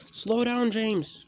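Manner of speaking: high, loud shout; urgent, high-pitched yell; alarmed cry. A narrator's reading. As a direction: yell for the engine to slow. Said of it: "cried his, his driver".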